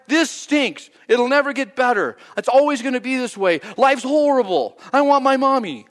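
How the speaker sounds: put-on childish lament of an honest heart; hammed-up despairing cry; whiny complaint. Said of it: "And the cry of your heart".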